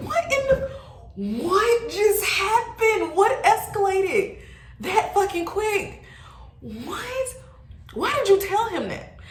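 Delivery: high-pitched, drawn-out disbelief; incredulous high squeal, stretched words; shocked outburst. Retold or imitated. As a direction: act out being floored, voice shooting way up. Acting out shocked reaction to friend.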